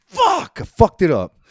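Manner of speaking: real angry yelp, high and loud; real frustrated outburst. Real, not acted. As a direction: blurt it out after fumbling the intro. Host messes up episode number.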